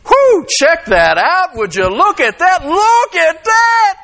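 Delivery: slow, high, drawn-out wolf admiration; hammed-up gawking at a passing woman; mock ogling. Preacher acting out his mind exploding at a woman.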